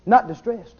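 usual speaking voice

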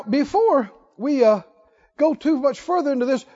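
usual speaking voice

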